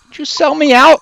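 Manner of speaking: loud, high accusation; accusing. Acted. Captive confronts old colleague over betrayal, accusing.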